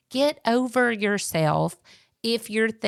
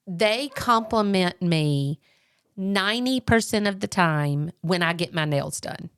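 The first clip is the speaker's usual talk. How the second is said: usual speaking voice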